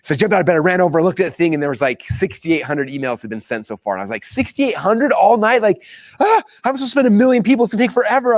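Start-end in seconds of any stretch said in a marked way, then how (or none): 4.2-8.3 high-pitched retold exasperation; frustrated. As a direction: wail it in mock dismay, much higher. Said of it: "I was all frustrated".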